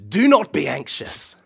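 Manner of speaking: loud, stern, high command; all caps command. Shown as a demonstration. Preacher shows the harsh all caps reading, loud and stern. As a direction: bark it at the guilty listener. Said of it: "as if Paul had written it in all caps letters".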